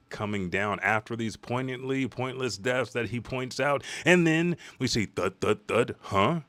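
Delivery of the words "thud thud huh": ordinary delivery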